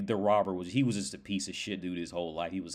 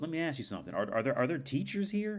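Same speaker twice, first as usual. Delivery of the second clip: higher, tentative mock questioning; lightly voiced doubting robber; 7 semitones higher than usual. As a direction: lightly act a puzzled man probing.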